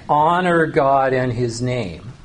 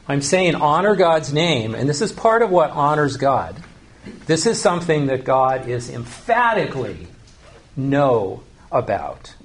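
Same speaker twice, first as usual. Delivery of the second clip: ordinary delivery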